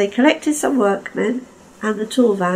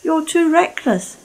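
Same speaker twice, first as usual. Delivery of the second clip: higher, scolding; Caldy rebuking; 8 semitones higher than usual. Acted. Caldy scolds reckless Lord Harry, pitched up. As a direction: tell him off firmly.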